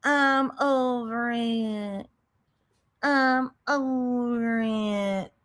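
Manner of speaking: drawn-out, weary I'm over it; slow, weary quoting. Retold or imitated. Mocking her friend, slow and weary. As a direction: drag it out like she's done. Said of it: "she was like".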